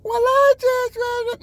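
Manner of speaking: very high, slow, drawn-out mock girly voice; mocking woman's voice. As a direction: sneer at the put-on female voice, way up high. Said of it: "Try to talk like a woman".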